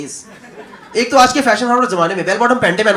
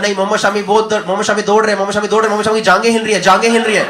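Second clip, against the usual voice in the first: fast mock Urdu Punjabi commentary; lightly done Punjabi commentator accent; Shoaib's accent; 2 semitones lower, much faster than usual. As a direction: rave about the run like Shoaib Akhtar. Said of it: "he starts explaining his run".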